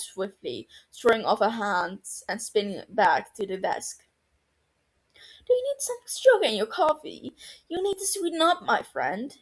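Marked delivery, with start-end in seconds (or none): 5.1-9.3 high, bright-pitched teasing chirp; teasing colleague chirps at grumpy doctor, high and sing-song; chirpy. A narrator's reading. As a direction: chirp it up high like a cheeky friend. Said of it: "she chirps".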